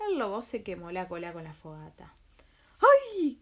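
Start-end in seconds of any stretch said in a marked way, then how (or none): 2.8-3.4 high, loud pained yelp; wolf's yelp of pain. Acted. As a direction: yelp as if your tail is burning. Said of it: "gritó el lobo".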